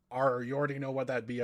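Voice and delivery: hearty pirate brogue. Acted. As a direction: growl it like a sea pirate. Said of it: "I'm doing a pirate voice".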